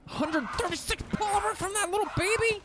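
high, rising disbelief at the haul; can't believe the numbers; astonished. Real, not acted. Gamer amazed by polymer from a penguin, astonished.

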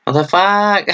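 high, startled exclamation; shocked outburst. Real, not acted. Reacting to a shocking anime scene, high and startled. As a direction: blurt it out in disbelief.

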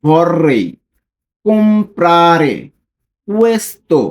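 slow, word by word with pauses; each word separated, careful and slow; read slowly. Shown as a demonstration. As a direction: spell it out slowly for learners. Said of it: "আমি slowly যদি পড়ি".

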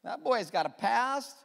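high teasing ooh, knowing gossip tone; sassy ooh. Pretend. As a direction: gossip about him with a sly ooh. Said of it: "point a finger at ... and say".

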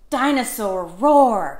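ordinary delivery

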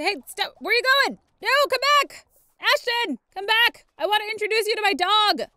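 high, loud, urgent hollering; calling after someone. Pretend. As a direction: shout after someone walking off. Calling after man walking away.

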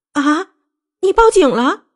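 high-pitched startled question; shocked, voice shooting up; agitated shock. A narrator's reading. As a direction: burst out in high disbelief. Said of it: "一下子激动起来".

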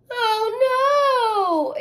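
high, loud crying "oh no"; mock sob. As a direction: wail it like a little girl. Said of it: "Ava sobbed".

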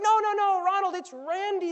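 high, loud and urgent; insistent servant. Acted. Servant urging the older brother to come in.

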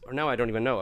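usual speaking voice

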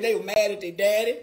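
normal talking voice

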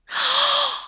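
sharp high gasp; a high dramatic gasp; shocked gasp. Acted. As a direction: gasp as if scandalised. Shocked at the principal.

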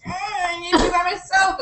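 high, whiny mock wail; mock distress. Retelling the heroine begging off, in mock distress. Retold heroine wants to be alone.

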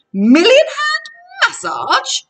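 loud, high, thrilled outburst; excited cry. A narrator's reading. Grover diving onto the massage bed, excited cry. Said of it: "Grover cried and dived in".

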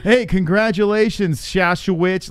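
hammed-up loud congratulations to a winner; mock announcer congratulations. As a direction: call out congratulations like a cage announcer. Reenacts announcer congratulating hard-named winner.

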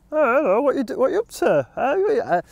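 high, sing-song friendly greeting; chirpy dog owner. Retold or imitated. Dog owner greeting him brightly in the woods, high sing-song voice. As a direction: chirp it like an overfriendly dog walker. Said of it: "And then owner comes".

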